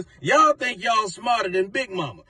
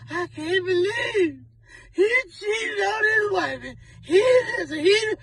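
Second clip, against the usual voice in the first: much higher hammed-up weepy wail; mock crying women; 11 semitones higher than usual. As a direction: parody disappointed women sobbing. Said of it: "All the women running around here disappointed".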